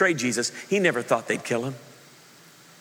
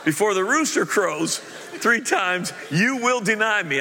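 slow, higher, laughing through the line; solemn Jesus voice, laughing; 8 semitones higher, slower than usual. Retold or imitated. Pastor redoing Jesus's words with a laugh, slow and solemn. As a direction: corrects the crow, half laughing, as Jesus. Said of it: "I just put a crow in the Bible".

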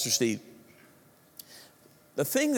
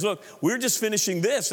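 usual speaking voice

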